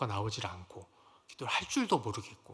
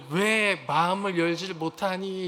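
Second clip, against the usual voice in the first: louder, slow imitated weeping cry; weeping plea; 5 semitones higher, 9 dB louder, slower than usual. Teacher beating the student's chest in prayer, louder weeping plea. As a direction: beg through tears while striking the chest. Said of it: "이러면서 막 우시는 거예요".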